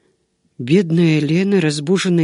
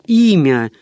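loud one-word command; insistent, raised-voice order; stern demand; 5 dB louder than usual. A narrator's reading. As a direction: demand it forcefully, cutting through objections. Monk demands the name over objection.

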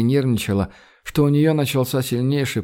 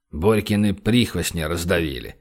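a lightly voiced lower male character, obliging; ready answer; 7 semitones lower than usual. A narrator's reading. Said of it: "с готовностью ответил тот". (с готовностью, низким голосом)

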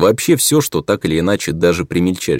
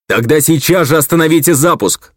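high, loud urgent command; loud exclamation; 9 semitones higher, 5 dB louder than usual. Acted. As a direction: shout the order to stop. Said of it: "громко воскликнул Израил".

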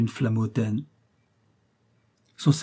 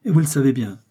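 mildly impatient, a little louder; some impatience; 3 semitones higher, 5 dB louder, faster than usual. A narrator's reading. Young princess answering the general, with some impatience. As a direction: push back with a touch of impatience. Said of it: "avec quelque impatience".

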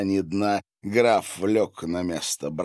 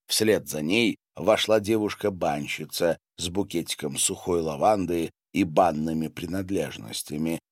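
ordinary delivery, nothing marked

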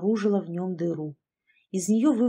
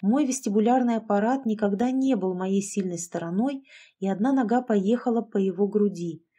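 ordinary delivery, nothing marked

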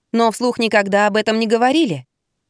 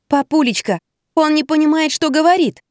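pleading; 7 semitones higher than usual. Acted. Daughter pleading, hanging on father.

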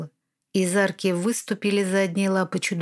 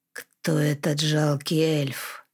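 low, slow menacing hiss; hissing dragon; 5 semitones lower, slower than usual. Acted. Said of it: "Раздалось шипение".

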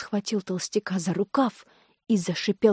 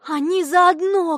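high, loud, drawn-out cry; shout; 8 semitones higher, 6 dB louder, slower than usual. A narrator's reading. Girl exposes doctor and impostor, shouting. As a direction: cry out the accusation slowly and loudly. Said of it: "крикнула Юлька".

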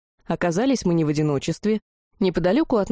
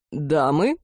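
lowered, manly courteous voice; deep bass greeting; 4 semitones lower than usual. A narrator's reading. Young man greeting admiring girls, deep bass. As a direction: drop low to greet the ladies. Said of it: "густым басом поприветствовал их".

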